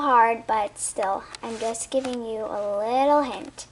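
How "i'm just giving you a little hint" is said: ordinary delivery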